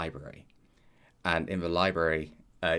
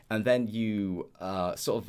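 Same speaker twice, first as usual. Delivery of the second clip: usual speaking voice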